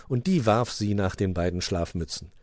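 ordinary delivery

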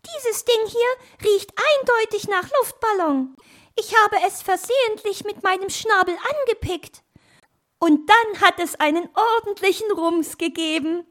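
very high chirpy character voice; little bird. Acted. Little bird explains pecking the balloon, very high voice.

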